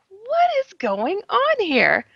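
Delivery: high, slow, drawn-out disbelief; incredulous. Retold or imitated. Woman confronting aliens who fake her nephew, high stunned disbelief.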